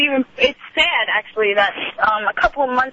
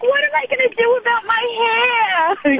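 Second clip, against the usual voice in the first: high-pitched mock wail of dismay; disgusted mother; 10 semitones higher, faster than usual. Daughter mimics mother upset over spat-on hair, high disgusted wail. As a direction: imitate a mother lamenting, pitched way up. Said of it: "she was so disgusted".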